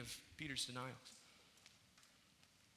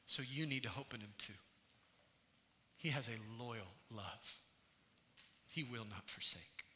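quiet testimony; 5 semitones lower, 7 dB quieter than usual. Acted. Believer's quiet testimony to others.

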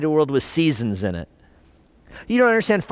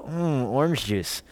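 savoring; 2 semitones lower, 6 dB quieter, slower than usual. Retold or imitated. Preacher imitating someone tasting orange juice, softly savoring. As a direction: savor it like a first sip. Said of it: "and you go".

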